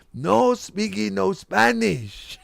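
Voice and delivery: very slow mock Spanish accent; hammed-up Spanish accent; fake Spanish accent. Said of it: "with a fake Spanish accent".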